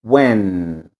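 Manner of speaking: "when" with the tongue up at the roof of the mouth; teacher modelling "when"; careful English when. Shown as a demonstration. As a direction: say "when" slowly and clearly, tongue to the palate. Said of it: "A língua lá no céu da boca".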